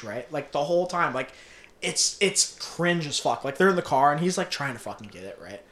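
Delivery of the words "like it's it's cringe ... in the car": ordinary talk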